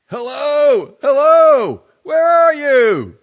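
very high, loud shout; mounting urgent shout. A narrator's reading. Husband shouting for his dead wife with mounting urgency. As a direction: shout out in growing alarm. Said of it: "call with mounting urgency".

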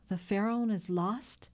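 ordinary delivery

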